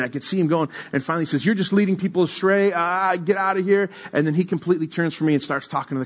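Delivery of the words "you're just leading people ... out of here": mildly heated, higher-pitched mock dismissal. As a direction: imitate a frustrated preacher brushing someone off. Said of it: "I could hear him getting heated".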